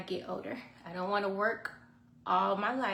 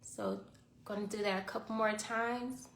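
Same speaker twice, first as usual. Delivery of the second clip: guided meditation; 7 dB quieter than usual. Acted. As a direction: calmly guide the breathing. Leads a short guided meditation.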